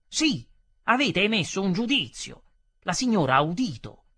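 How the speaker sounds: loud, emphatic insistence; angry accusation. Acted. Customer insisting that the lady heard, angry and loud.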